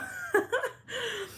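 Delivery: high, breaking laugh; real laugh. Real, not acted. Storyteller amused by the husband getting out, laughing. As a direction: laugh at your own story in a high pitch. Laughs at her own retold remark.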